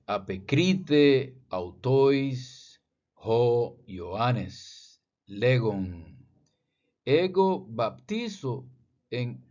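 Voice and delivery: slow, deliberate reading of ancient Greek. Shown as a demonstration. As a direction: sound out each Greek word carefully. Reads Greek text slowly.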